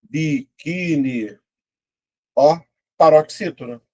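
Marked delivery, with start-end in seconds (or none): 0.0-1.4 word drawn out slowly, syllable by syllable; slow dictation. Shown as a demonstration. Teacher slowly reading out a quiz word. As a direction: spell it out slowly for the class. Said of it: "Quarenta e cinco".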